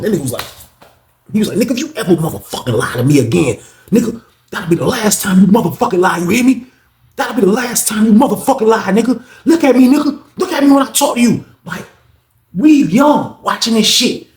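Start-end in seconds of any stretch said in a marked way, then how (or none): 1.6-11.4 building, high menacing threat; voicing an inmate threatening, rising in pitch. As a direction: pin him and warn him. Reggie threatening against wall.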